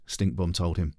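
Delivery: usual speaking voice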